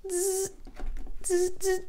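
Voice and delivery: high buzzing zap noise. Pretend. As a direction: make a high electric zzz as if clearing energy.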